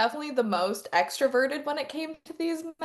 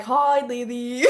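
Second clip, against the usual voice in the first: slow, loudish, off-target Australian greeting; botched Australian accent; 3 semitones lower, 4 dB louder, much slower than usual. Retold or imitated. Group of guys greeting Lily, exaggerated Australian-sounding drawl. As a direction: drawl it like a bad Australian shark. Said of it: "What part of Australia".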